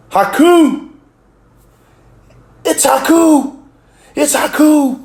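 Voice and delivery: high, loud excited yelling across the room; excited shout. Retold or imitated. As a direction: yell the name like a starstruck fan. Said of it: "All of a sudden I hear".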